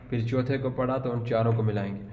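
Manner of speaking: normal talking voice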